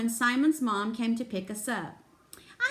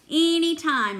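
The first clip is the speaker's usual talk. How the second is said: lightly squeaked, louder and higher; hamster squeak; 5 semitones higher, 5 dB louder than usual. A narrator's reading. Hamster answers a dad's invitation, light squeak. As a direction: pipe up with a mild squeak. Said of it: "I squeaked".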